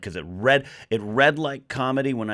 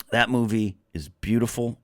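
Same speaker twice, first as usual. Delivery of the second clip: ordinary talk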